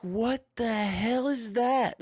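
high, slow imitation of kids' voices; startled kids. Retelling the kids' reaction on headset, high mock child voice. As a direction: mimic the kids freaking out, high and drawn out. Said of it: "And they were like".

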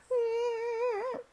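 high little kitten mew; kitten whimper. A narrator's reading. Kitten whimpers when the lion cub walks off. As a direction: squeak it like a worried kitten.

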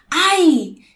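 loud, high exclaimed "ay"; demo interjection. Shown as a demonstration. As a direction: cry out "ay" as a textbook example. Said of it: "mostramos nuestras emociones con sonidos".

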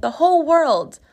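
put-on high little-boy answer; childish high-voiced declaration; seven-year-old nephew. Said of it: "And he's like".

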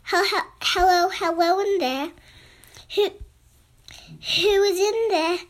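a timid animal calling into a scary cave; a touch of a scared hyena voice; nervous hyena. Acted.

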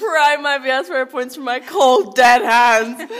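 loud, hammed-up last stand; mock defiance. Student refusing to surrender school points, in loud mock defiance. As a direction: build to a defiant comic shout.

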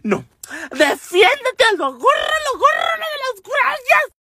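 very high screamed urging; hammed-up frantic squeal; rowdy shrieking. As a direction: imitate hysterical girls cheering on a fight. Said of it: "las pinches cuatas bien escandalosas".

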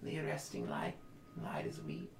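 slow eerie seer voice; a hint of a spooky oracle; creepy oracle voice. GM voicing oracle figure.

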